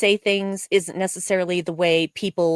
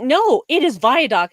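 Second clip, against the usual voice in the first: insisting coworker; 5 semitones higher, 5 dB louder than usual. Retold or imitated. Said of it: "and she's like".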